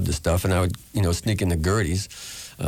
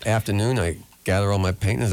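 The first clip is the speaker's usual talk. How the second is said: ordinary talk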